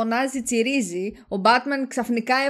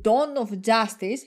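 normal talking voice

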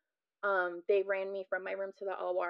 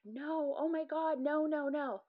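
retold scream; 9 semitones higher, slower than usual. Patient recalling the rush back to surgery, muted scream. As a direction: imitate a desperate scream without volume. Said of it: "I feel like I was screaming".

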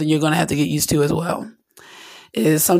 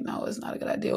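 whispered no; 12 dB quieter, faster than usual. Retold or imitated. God's quiet no.

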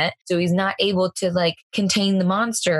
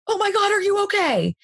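high, shrill-free panicked yell, replayed; mock yell; 14 semitones higher than usual. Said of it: "I immediately yell".

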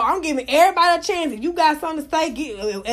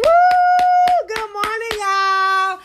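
much higher, loud Woo then greeting; pumped morning hello; excited greeting; 9 semitones higher, 6 dB louder, much slower than usual. Real, not acted. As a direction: greet the room with a whoop. Greeting the room.

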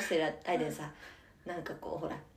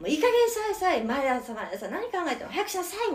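scolding mother; 7 semitones higher than usual. Retold or imitated. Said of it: "みたいなことになるのは簡単".

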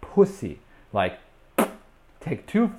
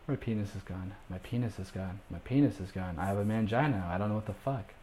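inner voice; 2 semitones lower, 11 dB quieter than usual. Retold or imitated. Man faking cheer among partying friends, soft inner-thought panic. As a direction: murmur it privately, defeated, under the breath. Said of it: "in the back of my head".

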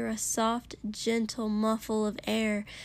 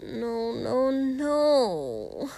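whining; 2 semitones higher, much slower than usual. Acted. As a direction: whine it out. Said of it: "Denki whined at him".

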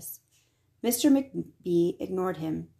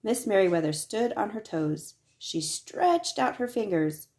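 ordinary delivery

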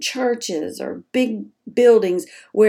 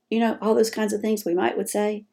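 ordinary talk, nothing marked

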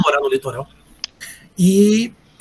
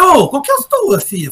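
retold confrontation; 8 semitones higher than usual.

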